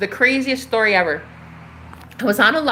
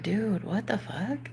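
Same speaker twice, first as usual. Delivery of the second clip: slow, much softer stunned murmur; stunned disbelief; 12 dB quieter, slower than usual. Retold or imitated. Hearing the neighbour quit his job, soft slow stunned. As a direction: breathe it out in quiet shock. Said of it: "And I was like".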